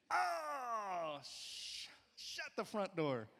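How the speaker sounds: high, strained yelp swallowed into a slow minced curse; a stubbed toe biting back a swear; mock pain outburst. Pastor acting out a stubbed toe, high strained yelp.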